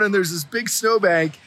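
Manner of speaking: ordinary delivery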